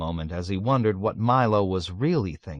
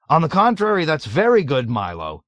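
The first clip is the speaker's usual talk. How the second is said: higher, louder quick backpedal; hasty correction; 7 semitones higher, 6 dB louder than usual. A narrator's reading. Colonel hastily correcting himself to Milo, flustered higher backpedal. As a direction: scramble to take it back. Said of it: "he corrected himself hastily".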